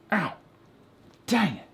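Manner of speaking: a real yelp of pain, then a high Dang it; real ouch as something jabs her; sudden pain. Real, not acted. Voicing a sudden sting while handling a holiday ornament, a pained ow.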